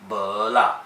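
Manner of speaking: slow bo dipping and pulling back up, then la; fifth tone on bo. Shown as a demonstration. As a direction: stretch bo down and up before la. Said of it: "bo uses that fifth tone".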